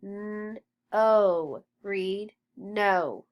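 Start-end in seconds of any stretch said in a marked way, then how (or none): 0.0-1.6 N-O sound by sound, N held then a long O. Shown as a demonstration. Teacher modelling phonics for the class, sounded out. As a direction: stretch N-O into its separate sounds.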